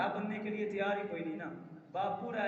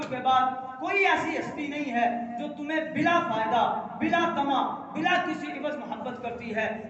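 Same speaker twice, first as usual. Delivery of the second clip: louder, fervent sermon peak; preaching at full force; 4 semitones higher, 6 dB louder than usual. Acted. Preacher praising parents' selfless love, fervent. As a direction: press the point on the listeners.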